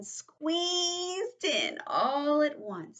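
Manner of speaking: high, long-drawn "squeezed"; slow, high squeeze on the words. A narrator's reading. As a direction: stretch it out high as if squashed. Story word acted out as squeezing.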